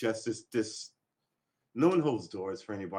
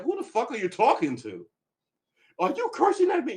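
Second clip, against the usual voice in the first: higher, louder indignant comeback, rising to a shriek; indignant retort; 12 semitones higher, 5 dB louder than usual. Retold or imitated. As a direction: snap back in outrage at a stranger. Quarrel with woman on street.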